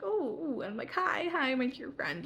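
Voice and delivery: high, playful sing-out greeting; acting cute and quirky to charm a friend; retold quirky, high-pitched hello; funny, quirky. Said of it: "I'm trying to be funny, like, quirky".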